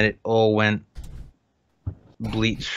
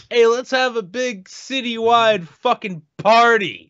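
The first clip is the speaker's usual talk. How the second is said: mock party cheer; 10 semitones higher, 3 dB louder, slower than usual. Partying New Orleans fans quoted.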